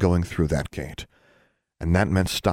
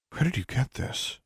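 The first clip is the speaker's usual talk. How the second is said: higher, soft, pointed question; questioning dean; 7 semitones higher, 7 dB quieter than usual. A narrator's reading. Dean holding the time turner, questioning quietly. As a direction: ask quietly in a raised pitch.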